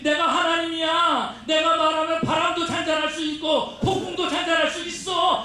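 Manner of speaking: high, forceful preacher's proclamation as Jesus; commanding divine declaration. Acted. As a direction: proclaim mastery over the storm at high pitch. Preacher voices Jesus commanding the storm. (높은 목소리로 힘차게 선포하듯)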